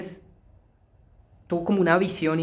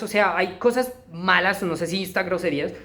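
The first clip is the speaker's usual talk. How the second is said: ordinary delivery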